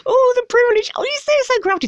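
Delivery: high, loud fake rapture; mock gushing. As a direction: gush over it with exaggerated, sarcastic awe. Mocking gushing over the privilege of giving.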